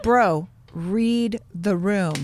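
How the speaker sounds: very slow, drawn-out reprimand; slow retold rebuke. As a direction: spell it out slowly for someone not getting it. Retold rebuke to an insistent partner.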